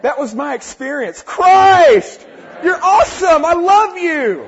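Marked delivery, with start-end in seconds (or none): 1.2-4.4 loud, high shout of love; joyful shout. Acted. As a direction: cry it out in worship. Exclaiming love to Christ.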